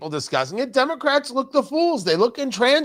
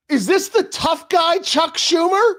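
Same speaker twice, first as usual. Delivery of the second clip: mocking; 7 semitones higher than usual. Real, not acted. Mocking Schumer's tough-guy video.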